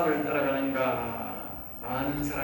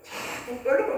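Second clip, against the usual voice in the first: high, drawn-out mocking imitation; lightly mocking the dragging speech; mimicking drawn-out speech; 11 semitones higher, slower than usual. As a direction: stretch the words out high, teasing. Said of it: "까투리를 잡고 깍".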